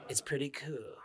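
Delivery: barely voiced, totally chilled-out impression; Cartman. Shown as a demonstration. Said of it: "Basically, I become Cartman".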